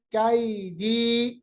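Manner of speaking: कैदी slow, कै softly drawn; soft drawn vowel. Shown as a demonstration. Teacher models कैदी slowly. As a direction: draw out कै gently. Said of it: "या को मुलायम आवाज कीजिएगा".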